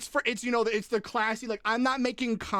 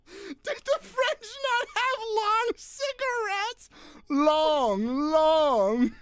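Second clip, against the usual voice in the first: high, breaking with laughter; mock the question through giggles; repeating it while laughing hard; laughing mimicry; 8 semitones higher, much slower than usual. Real, not acted.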